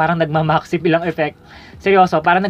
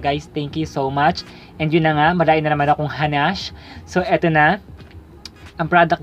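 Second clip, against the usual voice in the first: ordinary talk, nothing marked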